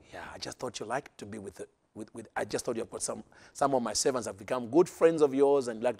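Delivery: father softly telling son he is loved; tender father. Acted. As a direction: speak gently as a surprised loving father. Father gently explaining.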